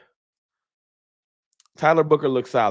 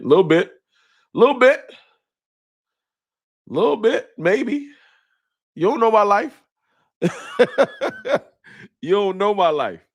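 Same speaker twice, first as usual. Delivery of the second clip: much higher, playful put-on voice; mock defensive; 8 semitones higher than usual. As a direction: play hurt and touchy, half laughing. Mock defensive about missing quarterbacks.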